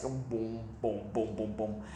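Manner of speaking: hushed low booms; soft sound effects. Acted. Story reader making soft plopping booms for kids. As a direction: drop each boom softly.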